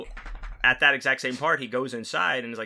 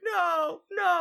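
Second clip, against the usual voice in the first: much higher half-hearted victim squeal; limp mock protest. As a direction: squeal no with no real fight. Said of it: "barely struggling".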